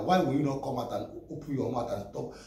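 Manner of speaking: ordinary delivery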